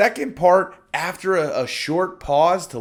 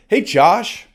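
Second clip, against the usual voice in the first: put-on bright, louder, higher hello like to an old friend; familiar greeting; 5 semitones higher, 6 dB louder than usual. Shown as a demonstration. Sales coach greeting a prospect like an old friend. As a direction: greet him as if you already know him. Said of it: "Say this like you already know them".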